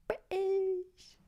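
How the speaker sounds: high taunting British; mock jeer. Taunting the other guy with a high jeer.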